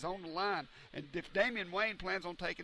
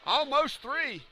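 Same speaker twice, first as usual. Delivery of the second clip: excited commentary; 7 semitones higher, 5 dB louder, slower than usual. Real, not acted. Near fall called.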